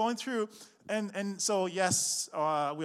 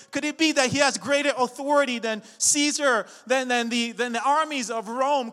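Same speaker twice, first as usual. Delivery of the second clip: full-force preaching; 6 semitones higher, 14 dB louder than usual. Acted. Pastor preaching to the congregation in full force. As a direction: build the sermon to a roar.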